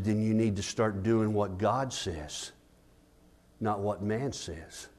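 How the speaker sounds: low, quiet admonition; retold pastoral counsel. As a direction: advise softly, pausing before the contrast. Retells his counsel to the couple.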